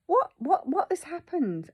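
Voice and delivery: high, loud stammered bewilderment; distraught disbelief. Retold or imitated. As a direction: reel in shock, voice pitched right up. Acting out her stunned, upset thought.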